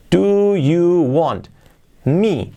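very slow "Do you want", each word apart; slow and careful. Shown as a demonstration. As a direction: stretch it out. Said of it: "Querer, want". (despacio, palabra por palabra)

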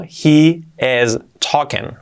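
"he is talking" said slowly, each word apart; slow teaching "he is talking"; uncontracted, word by word. Shown as a demonstration. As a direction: draw out "he is talking" with "he" and "is" separate.

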